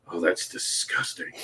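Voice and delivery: imitated passerby, low and very soft; hushed disgust. Passerby at the booth, hushed disgust. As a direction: mutter disapproval under your breath.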